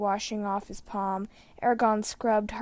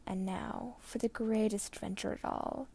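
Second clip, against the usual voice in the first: soft, faint farewell; last words; 7 dB quieter than usual. A narrator's reading. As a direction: let the final line fade out peacefully.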